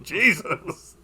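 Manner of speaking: mock shock. Reacting to the stud farm joke, mock shocked groan. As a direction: groan it in amused disbelief. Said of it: "I was like".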